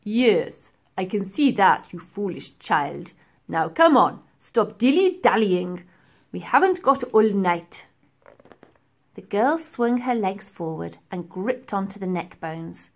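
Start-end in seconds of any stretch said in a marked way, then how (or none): 0.0-7.9 put-on louder, sneering old man voice; impatient professor. A narrator's reading. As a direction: scold her impatiently. Professor scolds the girl.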